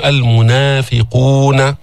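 المنافقون with both long vowels held for two beats; natural madd. Shown as a demonstration. Teacher models المنافقون slowly. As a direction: stretch the a and u in المنافقون evenly. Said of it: "مد طبيعي".

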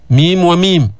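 ordinary talk, nothing marked

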